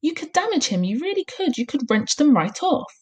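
high, urgent pleading voice; begging. A narrator's reading. Teacher pleading for a boy's ears, begging. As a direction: warn her in a pleading high voice. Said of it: "begged Miss Honey".

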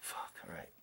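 voiceless, resigned whispered curse. Retold or imitated. Seeing the distance left, he whispers in resignation. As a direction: breathe it out under your breath. Resigned at long walk ahead.

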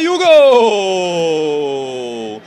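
explosive goal commentary. Acted.

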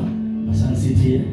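ordinary talk, nothing marked

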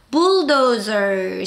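bulldozer very slow with a curled r sound; rolled bulldozer. Shown as a demonstration. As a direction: roll through bulldozer slowly. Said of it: "시작".

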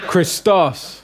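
Christos punched on the final -OS; stress on last syllable. Shown as a demonstration. Teacher correcting a student, Christos stressed on the end. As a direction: hit the end of Christos, kris-TOS. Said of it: "the accent is on the omicron".

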